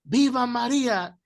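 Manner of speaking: mock shout of devotion; retold shouting. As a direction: belt it out like a stubborn old man. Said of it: "viejecitos de la vieja guardia gritando".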